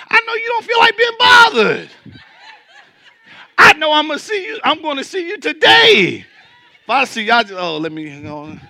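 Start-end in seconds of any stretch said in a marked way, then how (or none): 0.1-6.2 very high, loud shouted punchline; high, loud preacher shout; preaching shout. Real, not acted. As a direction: shout it to the back row. Preaching climax, laughing shout.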